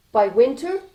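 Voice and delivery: plain reading voice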